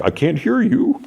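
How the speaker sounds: very high, rising clown-like taunt; Pennywise taunt. Retold or imitated. Clown mocks the boy on the phone, high taunting voice. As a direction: tease in a high-pitched voice, lifting at the end. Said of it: "And Pennywise is like".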